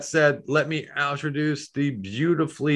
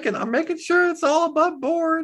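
high, squeaky cartoon object voice; high character voice, slowing at the end; high-pitched talking needle; 3 dB louder than usual. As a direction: play a chirpy little needle, pitched way up. Plays a figure, Needle.

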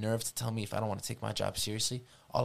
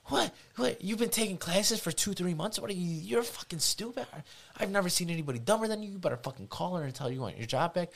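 furious grandfather; 11 semitones higher than usual. Retold or imitated. Grandfather berates his grandson for quitting, high furious yell. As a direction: scream at him like a livid grandpa.

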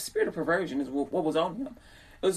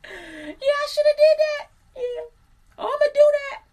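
excited listener; 6 dB louder than usual. Retold or imitated. Listener vowing to copy her, high excited squeal. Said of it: "will make you, like, go".

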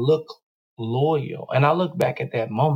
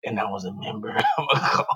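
a little raspy, lower, laughing; 5 semitones lower, 4 dB quieter, faster than usual. Shown as a demonstration. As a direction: rasp it out dramatically. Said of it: "say it with, like, a little raspy voice".

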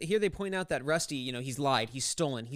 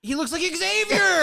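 excited reveal; 12 semitones higher, 6 dB louder, slower than usual. Real, not acted. Excited realization, building on the joke.

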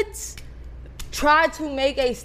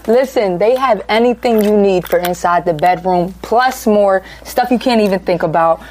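ad read; 7 semitones lower, 7 dB louder than usual. Acted. Sponsor ad read.

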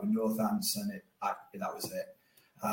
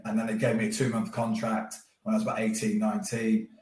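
ordinary talk, nothing marked

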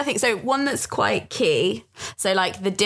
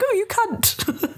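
drawn-out, high mock insult; cutting insult; 13 semitones higher, much slower than usual. Shown as a demonstration. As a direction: sneer it slowly, way up high. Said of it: "in English it sounds a little bit more cutting".

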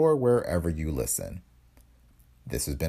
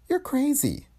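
very high-pitched protest; shocked denial; slower than usual. Acted. Woman accused of helping murder, shocked.

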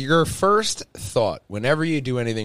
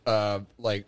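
ordinary talk

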